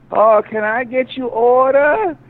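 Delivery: high, slow put-on voice; mock waitress. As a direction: play the sweet clueless waitress. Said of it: "she's like".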